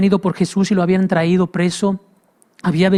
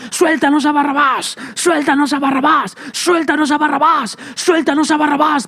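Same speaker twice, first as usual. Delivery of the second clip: high chanted crowd shout, over and over; preacher voicing the mob calling for Barabbas, high shouting; 9 semitones higher than usual. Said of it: "empezaron a gritar".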